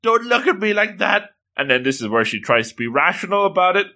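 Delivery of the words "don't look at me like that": high-pitched gushing, acted; hammed-up melting at cuteness; struggling to resist. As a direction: draw it out high, as if giving in. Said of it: "And she's struggling".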